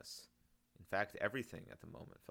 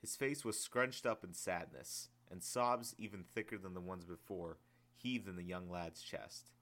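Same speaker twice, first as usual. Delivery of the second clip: ordinary delivery, nothing marked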